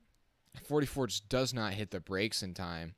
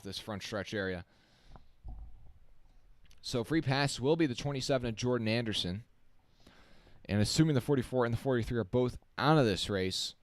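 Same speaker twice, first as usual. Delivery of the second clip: live play-by-play; 2 semitones higher, 6 dB louder than usual. Acted. Calls the race live.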